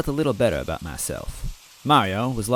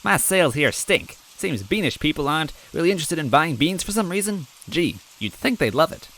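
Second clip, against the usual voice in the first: higher, chipper merchant voice; cheery bean vendor; 8 semitones higher than usual. Acted. Bean vendor chatting cheerfully to the fallen stranger. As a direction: play the cheerful, odd bean seller.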